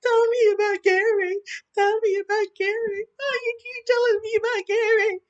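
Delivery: shrill hammed-up impression of her pleading; mocking her. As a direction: parody her begging voice, loud and high. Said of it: "when she was like".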